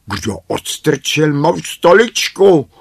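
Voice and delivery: high, loud questioning dwarf voice; high-pitched, loud dwarf complaint; indignant first dwarf. A narrator's reading. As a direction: demand to know who did it. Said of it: "ptal se první".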